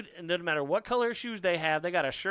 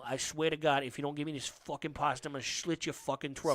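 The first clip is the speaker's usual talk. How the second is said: lower, gruff mobster voice; Tony Soprano impression; 3 semitones lower than usual. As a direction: threaten like a lightly done mobster. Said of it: "sounds like Tony Soprano".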